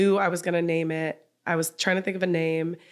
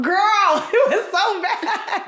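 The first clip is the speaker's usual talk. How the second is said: much higher, laughing hard; 13 semitones higher, 4 dB louder, slower than usual. Real, not acted. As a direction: crack up while saying it.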